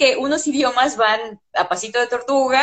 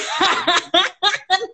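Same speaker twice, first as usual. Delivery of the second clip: high giggle at her own joke; high laugh; 7 semitones higher than usual. Real, not acted. Teacher laughing at her own warning to the students, high giggle. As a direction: break into a bright high laugh.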